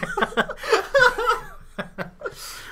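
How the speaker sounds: shrieking cackle of real laughter; high burst of laughter. Real, not acted. Host cracking up at his co-host's rambling, laughing high.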